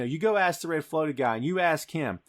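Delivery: ordinary talk, nothing marked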